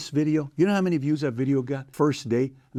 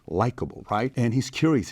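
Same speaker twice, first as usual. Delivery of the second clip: usual speaking voice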